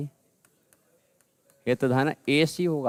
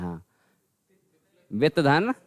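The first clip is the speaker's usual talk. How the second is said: normal talking voice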